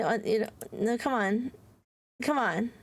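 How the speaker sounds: ordinary talk, nothing marked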